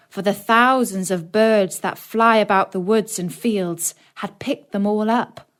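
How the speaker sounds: ordinary delivery, nothing marked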